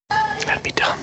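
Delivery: mock-secret whispered repeat; whispered aside. Repeating a verdict on movie writing, in a whispered aside. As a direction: whisper it under the breath.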